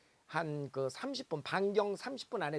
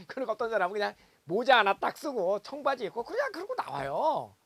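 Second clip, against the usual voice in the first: normal talking voice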